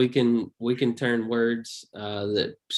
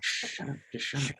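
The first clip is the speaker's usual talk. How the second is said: whispered shushing; hushing whisper; 4 semitones higher, 8 dB quieter than usual. Retold or imitated. Friend hushing a drunk heckler, whispered. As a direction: hiss it like a friend at the table. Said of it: "somebody at the table ... that's like".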